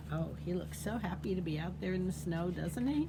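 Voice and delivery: plain reading voice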